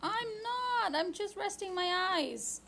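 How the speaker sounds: high, loud defensive protest; startled denial. Acted. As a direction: jolt awake and deny it. Caught dozing, denies it.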